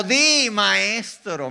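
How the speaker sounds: very slow, drawn-out mock deference; hammed-up fawning pupil; two-faced hypocrite. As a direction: stretch each word in fake reverence. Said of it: "mira el hipócrita".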